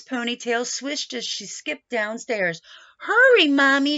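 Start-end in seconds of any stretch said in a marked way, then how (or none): 3.0-4.0 high, loud eager call down the stairs. Acted. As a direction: shout "Hurry, Mommy" up high and loud. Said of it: "she called".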